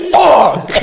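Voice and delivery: high, loud mock cry; hammed-up exclamation of dismay; mortified curse. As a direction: re-enact the horror of being caught. Caught out, retold.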